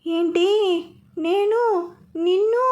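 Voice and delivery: high, fearful half-question that stops; scared, unable to finish the sentence; frightened breaking off. A narrator's reading. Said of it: "ఆగిపోయింది భయంతో".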